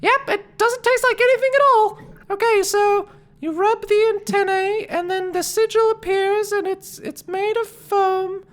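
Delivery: very high, loud creature voice; Flea's voice. Acted. Flea tastes the foam and recites the pitch, very high voice. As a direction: talk way up high like a tiny creature. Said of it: "And he says".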